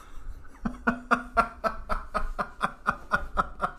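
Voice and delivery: breathy real laugh. Real, not acted. Laughing at own joke.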